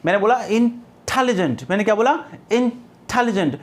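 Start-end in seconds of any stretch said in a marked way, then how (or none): 0.4-1.6 in-TEL-ligent, the टे of intelligent prominent; stress on second syllable. Shown as a demonstration. Teacher demonstrates prominence in intelligent, stress on second syllable. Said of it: "syllable को ज़्यादा prominence दिया है". 2.5-3.6 intelligent with a prominent TEL after a light first syllable; stress on second syllable. Shown as a demonstration. Teacher repeats intelligent for students, stress on second syllable. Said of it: "syllable को ज़्यादा prominence दिया है".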